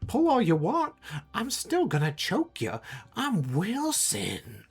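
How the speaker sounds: high-pitched taunting little monster; high, menacing toy-monster voice; creature voice. Acted. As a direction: taunt in a high creature voice, stretching out the name at the end. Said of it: "looks around and goes".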